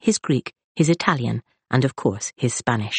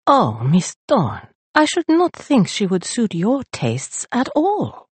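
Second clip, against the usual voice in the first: slow, higher, gently pained protest; a lightly voiced wince of distaste; pained librarian; 6 semitones higher, slower than usual. A narrator's reading. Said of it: "A pained look came across".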